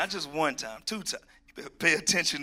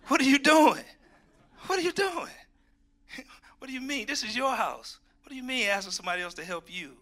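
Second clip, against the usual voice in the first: higher and louder, mock annoyed at the interruption; mock exasperation; 9 semitones higher, 4 dB louder than usual. Preacher imitating himself snapping at Martha, mock exasperated. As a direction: play it fed up and flustered. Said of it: "I'd be like".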